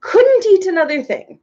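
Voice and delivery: high, puffing overstuffed Duke. A narrator's reading. Duke pushing his plate away, puffing. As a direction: puff it out, too full to move. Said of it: "puffed the Duke".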